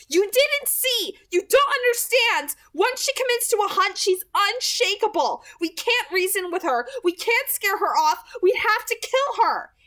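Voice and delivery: high, loud exasperated outburst; desperate to make them understand. A narrator's reading. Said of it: "huffed out a breath, exasperated".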